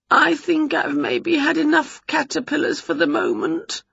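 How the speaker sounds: high, strained, queasy witch; uneasy, high-pitched politeness. A narrator's reading. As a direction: say it holding back nausea. Winnie feels sick.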